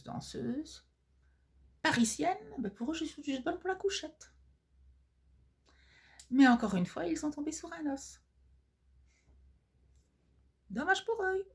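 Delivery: high, indignant diva voice. Acted. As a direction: climb up high in mock outrage.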